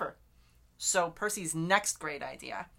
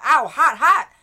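high, very loud yelps, climbing higher; pained yell; 9 semitones higher, 17 dB louder than usual. A narrator's reading. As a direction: yell it out like burning hands in a story. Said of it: "he yelled".

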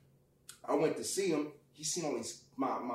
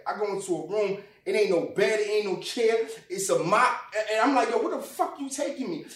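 louder, higher mounting outrage; rising anger; 6 semitones higher, 7 dB louder than usual. Retold or imitated. Telling how he was led into a mop room, rising angry yell. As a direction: build from disbelief to yelling. Getting irate in the hospital.

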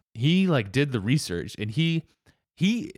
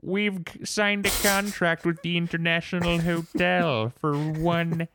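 higher put-on villain voice, very slow on "one million dollars"; Doctor Evil voice; 6 semitones higher, slower than usual. Joking podcast host quoting the manager's hotel deal as Doctor Evil, in a slow villain voice. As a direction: savor "one million dollars" like a ransom demand.

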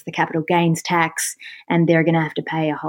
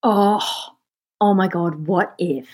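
ordinary delivery

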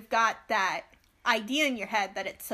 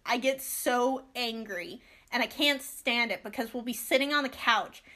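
usual speaking voice

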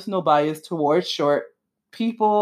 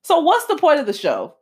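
higher, exasperated question; incredulous; 7 semitones higher than usual. Retold or imitated. Ranting to viewers about the show, incredulous and higher. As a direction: ask it like you can't believe it. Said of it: "I'm like".

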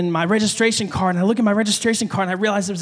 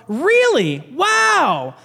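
high, drawn-out wow; amazed; 6 semitones higher, 3 dB louder, much slower than usual. Real, not acted. Pastor to someone never pulled over, playful amazement. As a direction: stretch it out in playful astonishment. Amazed at audience's show of hands.

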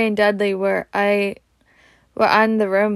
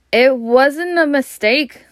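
beaming; 4 semitones higher, 4 dB louder, slower than usual. Acted. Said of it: "said Harry beaming".

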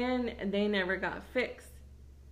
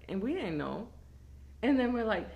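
ordinary talk

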